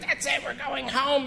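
high, mock-panicked wife voice; a hammed-up scared mother; freaked-out wife. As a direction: squeak it out like a frightened mom. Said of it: "she's freaked out".

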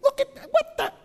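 mock exasperated squeal; mock road-rage outburst. Shown as a demonstration. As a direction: sputter at the other driver. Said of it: "Sounds something like that".

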